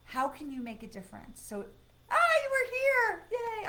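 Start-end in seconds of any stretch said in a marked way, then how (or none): 2.1-3.7 1 delighted surprise. Real, not acted. Viewer back from Florida vacation.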